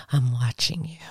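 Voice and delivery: breathy whisper, menacing; whispered threat. Pretend. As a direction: whisper it like a stalker.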